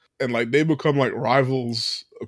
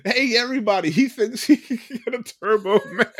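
mocking store clerk, higher, calling out to the crowd; 8 semitones higher than usual. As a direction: taunt him in front of the whole store, with a laugh breaking in. Store clerk mocks him to the crowd.

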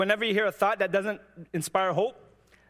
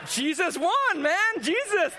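laughing cheer; 12 semitones higher, slower than usual. Retold or imitated. Answering his coworker who won, very high and laughing. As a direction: crow the win through laughter.

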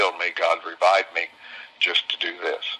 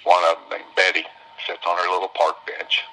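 normal talking voice

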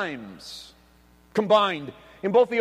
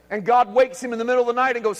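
normal talking voice

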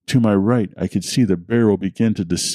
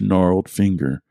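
ordinary delivery

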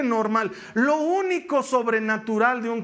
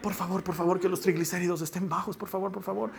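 hushed, softer pretend begging; play-acted murmured plea; mock anxious pleading; 3 semitones lower, 6 dB quieter, faster than usual. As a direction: plead under the voice, as if praying for luck. Mimics anxious plea over test results.

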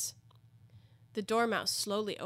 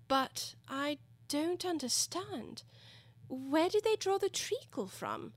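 high, slow, tentative question; very cautious; 8 semitones higher, slower than usual. A narrator's reading. Alice questions the touchy Dormouse, cautiously. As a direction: feel the way carefully, with pauses. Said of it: "she began very cautiously".

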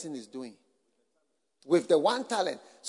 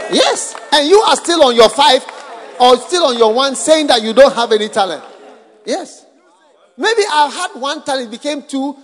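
rising, louder preacher's challenge; preaching at full force; 8 dB louder than usual. Acted. Preacher challenging the congregation about unused talents, building to a shout. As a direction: drive it home to the congregation, loud.

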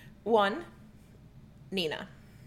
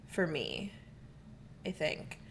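whispering; 2 semitones lower, 11 dB quieter, slower than usual. Real, not acted. Trailing private opinion, whispered.

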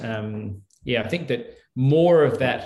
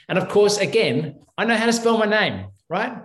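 higher and louder, incredulous that anyone would doubt it; recalling the guards' absurd claim, he protests higher and louder; exasperated protest; 9 semitones higher, 5 dB louder than usual. Retold or imitated. Exasperated retelling of passport accusation.

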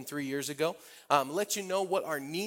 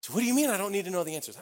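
fast, higher, louder mock disbelief; wide-eyed surprise; 6 semitones higher, 5 dB louder, faster than usual. Young believer questioning his mentor, acted surprise. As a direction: blurt it out as a baffled young man. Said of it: "he got really big eyes".

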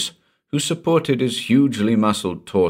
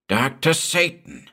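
high-pitched, falling exclamation to a master; breathed exclamation; 10 semitones higher than usual. A narrator's reading. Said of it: "breathed Gurs".

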